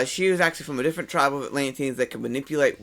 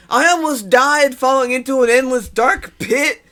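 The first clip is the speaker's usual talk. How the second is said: a hint of a breathless young hero, louder, slower, higher; excited young Barry; 9 semitones higher, 8 dB louder than usual. Cohost voicing young Barry, mildly excited and louder. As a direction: play up the kid's thrill at nearly falling.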